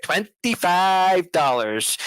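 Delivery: drawn-out, slow, unimpressed echo; slow mocking repeat. Imitating Nicholson mocking the tiny bet, slowly drawn out. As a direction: stretch every syllable, scoffing at the bet. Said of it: "And Nicholson's like".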